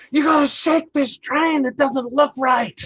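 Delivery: high, loud yelling order; yelled order. Retold or imitated. Director yelling from the train to shake it. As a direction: bark it up the train at the crew. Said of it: "and he yells".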